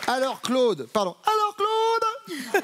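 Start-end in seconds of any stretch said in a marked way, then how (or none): 1.2-2.5 very high, drawn-out call; high-pitched call. Pretend. Host re-addressing contestant Claude, high-pitched put-on call. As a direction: sing it out way up high.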